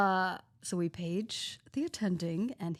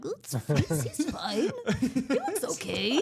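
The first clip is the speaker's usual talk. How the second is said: mock reassurance breaking into laughter; loud, giggly, unconvincing reassurance; laughing uncertainty; 3 semitones higher, 4 dB louder, slower than usual. Junior doctors reassuring parents, laughingly unsure.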